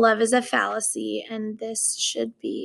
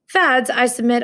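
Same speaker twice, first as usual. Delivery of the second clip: ordinary delivery, nothing marked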